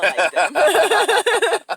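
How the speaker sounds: high, drawn-out laughing line. Real, not acted. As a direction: laugh it out slowly. Laughs at his own line.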